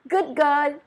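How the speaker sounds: a loud, pleased exclamation; old woman's cry. A narrator's reading. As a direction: call it out with delight. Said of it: "cried the old woman".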